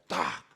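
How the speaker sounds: one sharp, low tac; last stone hitting. Retold or imitated. Preacher sounds the last stone falling, a single low tac. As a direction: drop the final stone with a low click. Se escucha la última piedra caer.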